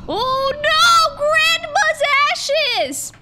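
drawn-out, high dramatic wail; mock horror. Gamer wailing over Grandma's spilled ashes, mock horror. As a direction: cry out in loud, exaggerated dismay.

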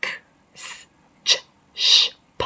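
k, s, ch, sh, p as bare unvoiced consonants; isolated consonant sounds, separated by pauses; voiceless sounds. Shown as a demonstration. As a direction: make each of k, s, ch, sh, p with breath only, no vowel. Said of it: "học theo những âm".

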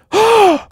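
sharp, very high startled gasp; a loud mock gasp of terror; imitated frightened gasp. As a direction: gasp loudly like a crowd in sudden fright. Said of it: "everybody in hell went".

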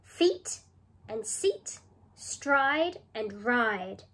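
Feet, seat, stride and ride set apart, slow; rhymes picked out. Shown as a demonstration. Teacher showing pupils which words rhyme, slow and spaced. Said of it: "Can you hear the rhyming words?".

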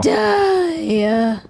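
drawn-out, high-pitched "duh"; exasperated duh. Real, not acted. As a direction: stretch it high like it's obvious. Mocking retort to a question.

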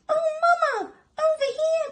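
squeaky, loud call for mother; alarmed babies' cry. Acted. Baby meerkats call mother over, high alarmed cry. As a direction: shout high like frightened little ones. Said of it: "cried the baby meerkats".

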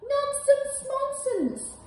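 squeaky little mouse. Acted. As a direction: scoff like a tiny mouse.

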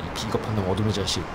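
muttered insult. Acted. Mocking insult.

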